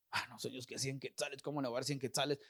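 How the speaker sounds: low, hushed objection; reluctant protest. Retold or imitated. Man objecting to God about giving money, low reluctant protest. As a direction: imitate someone grumbling privately, well under the voice.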